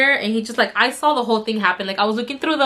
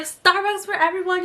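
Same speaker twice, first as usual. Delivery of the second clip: high, sing-out celebratory offer; retold excitement, pitched up high; happy announcement; 9 semitones higher than usual. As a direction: announce a treat for the whole group. Reenacting grateful, happy announcement.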